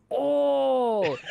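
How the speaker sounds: high, loud drawn-out "oh"; hammed-up cry of understanding; mock sudden realization. Traveler imitating the man who finally understands, mock realization. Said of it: "And he's like".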